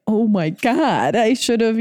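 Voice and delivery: drawn-out, slow "oh my God"; dawning realisation. Retold or imitated. Retelling his belated realisation of who he met, mock dismay.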